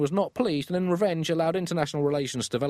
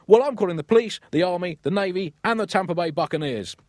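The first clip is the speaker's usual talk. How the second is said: louder and higher at first, then settling; one-up him with a silly yell; mock escalating shout; 6 semitones higher, 4 dB louder than usual. Farmer outdoing the pig owner's threats, mock shout.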